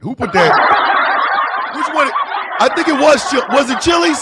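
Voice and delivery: high, laughing through the words. Real, not acted. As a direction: giggle while asking. Laughing at chat message.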